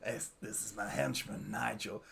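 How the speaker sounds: low, soft mock character voice; henchman introduction. Podcaster trying another British name, mock henchman introduction. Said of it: "British henchman".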